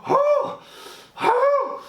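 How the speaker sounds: hammed-up victory cry; triumphant whoop. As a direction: whoop like you just won. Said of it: "he's like".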